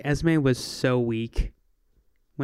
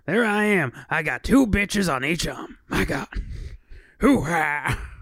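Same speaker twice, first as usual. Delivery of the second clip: Al Pacino; 3 semitones higher, slower than usual. Pretend. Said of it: "I wanted to read it as Pacino".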